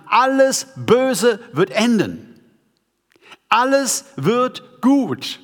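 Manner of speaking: high, emphatic preacher's proclamation; impassioned preaching. Real, not acted. Preacher telling the congregation all evil will end, impassioned proclamation. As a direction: proclaim it with rising force, stretching the key words. Rallying climax of the sermon.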